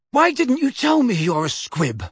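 put-on a teen hauling his cousin, panting a little; a hint of a winded boy; Harry. Harry voiced in story.

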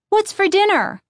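put-on high eager girl; kid voice. A narrator's reading. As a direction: ask like a hungry kid. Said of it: "Lindy asked".

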